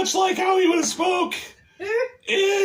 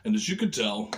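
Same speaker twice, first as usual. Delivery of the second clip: very low, quiet, far-off Jesus voice; deep Jesus voice; 8 dB quieter than usual. Acted. Puppet Jesus announcing his return to everyone, very low and quiet. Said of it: "I don't know why Jesus talks like this".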